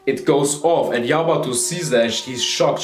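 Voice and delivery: ordinary delivery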